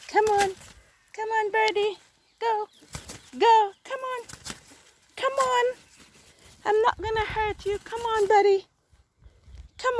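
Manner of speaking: high, sing-song coaxing; coaxing a wild bird. Real, not acted. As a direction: coax a frightened bird gently out. Coaxing trapped pigeon free.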